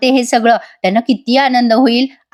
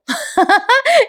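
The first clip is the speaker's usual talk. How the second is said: high real laugh; amused laughing; 14 semitones higher than usual. Real, not acted. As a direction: burst of high giggles. Amused at the misunderstanding.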